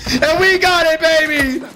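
victory whoop. Real, not acted. Celebrating the kill.

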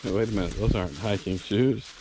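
ordinary talk, nothing marked

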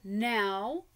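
ordinary delivery, nothing marked

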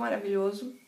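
usual speaking voice

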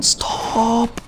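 high squealed imitation of a pestered friend; mock pleading protest. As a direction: cry out high, without extra volume. Friend keeps protesting, retold.

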